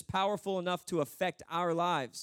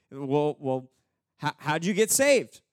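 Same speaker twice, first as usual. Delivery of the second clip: louder, stammering interrogation; questioning angel; 4 semitones lower, 8 dB louder, slower than usual. Acted. Angel questioning the thief at heaven's gate, flustered. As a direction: play a baffled gatekeeper pressing for answers. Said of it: "one of the angels is like".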